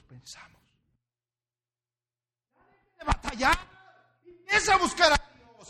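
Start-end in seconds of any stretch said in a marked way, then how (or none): 3.0-5.7 high, loud shouted sermon; fiery preaching. Acted. Pastor shouting at the congregation, fiery preaching. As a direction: preach at full force.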